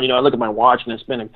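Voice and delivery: ordinary delivery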